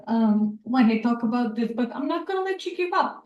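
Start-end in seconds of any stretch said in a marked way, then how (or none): 1.7-3.2 teary, higher and quicker, softer; after crying. Real, not acted. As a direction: push through the tears. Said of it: "sorry, I always get teary-eyed".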